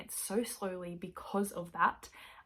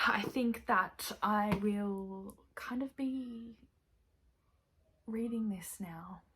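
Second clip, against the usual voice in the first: slow, soft whisper; 3 dB quieter, slower than usual. Real, not acted. Signing off to keep reading, whispered. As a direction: whisper it, drifting off to read.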